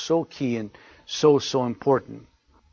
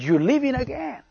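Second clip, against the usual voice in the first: high-pitched mimicry of an insistent wife; wife's insistent complaint; 9 semitones higher than usual. Wife answers her hushing husband, imitated high-pitched insistence. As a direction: repeat it as the wife, much higher in pitch. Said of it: "don't say it so loud".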